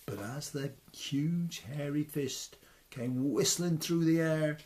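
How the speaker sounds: usual speaking voice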